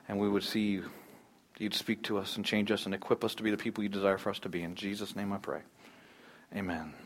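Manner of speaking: soft, earnest prayer voice; hushed pastoral prayer. Acted. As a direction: pray it quietly to God. Pastor prays before message.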